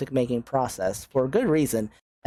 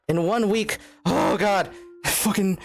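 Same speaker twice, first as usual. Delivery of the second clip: higher-pitched, nervy exclaiming; real nervousness; 6 semitones higher, slower than usual. Real, not acted. Streamer admits to chat the countdown is nerve-wracking, nervous. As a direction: let the nerves lift your pitch. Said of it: "actually kinda nerve-wracking".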